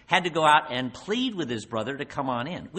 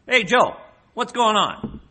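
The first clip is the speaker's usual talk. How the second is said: higher, louder, breezy "Hey, Joe"; casual call out; 10 semitones higher, 9 dB louder than usual. Acted. Older son calling a servant, casual and loud.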